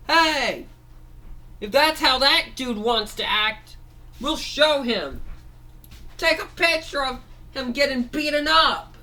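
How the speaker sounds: high, loud voice calling the gang to fight; rally the crew like a tough little robot girl; bossy machine girl. Acted. Machine girl tells her friends to film the beating, high bossy shout.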